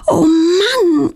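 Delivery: high, winded dismay; panting. A narrator's reading. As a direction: gasp it out after a getaway. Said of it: "keucht Claudia".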